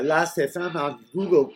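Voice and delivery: normal talking voice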